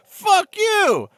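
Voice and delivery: high furious shout back; berserk rage. Acted. Voiced quarrel escalating.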